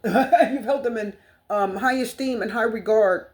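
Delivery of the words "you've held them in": slow, laughing at her own hiccups; laughing through hiccups. Real, not acted. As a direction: giggle through the words with hiccups. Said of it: "I'm hiccuping".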